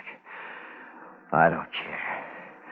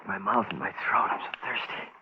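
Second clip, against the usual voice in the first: breathless dry whisper; exhausted whisper; 5 dB quieter than usual. Acted. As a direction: whisper it, too weak to speak up. Man alone, exhausted and parched.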